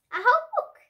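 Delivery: high, loud and thrilled; excited. A narrator's reading. Little girl thrilled about the teacher's book, loud and high. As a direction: burst out with wonder at the news.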